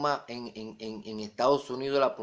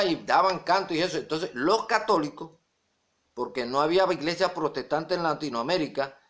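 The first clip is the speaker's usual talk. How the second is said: ordinary talk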